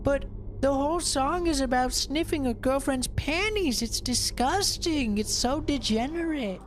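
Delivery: mimicking outraged mom. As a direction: ham up the shocked prude. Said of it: "And she's like".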